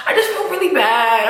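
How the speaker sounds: choked-up admission of feeling bad; real sadness coming through; emotional. Real, not acted. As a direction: let the emotion catch in the voice. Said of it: "I am getting emotional".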